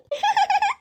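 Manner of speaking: high-pitched giggle; light, high laugh. Acted. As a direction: giggle shyly after being flattered. Daughter cheered up.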